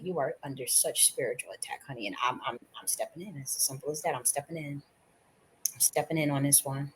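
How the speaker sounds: soft, reassuring protector; quiet, tender divine comfort; gentle spirit voice. Retold or imitated. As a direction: speak it softly to calm her.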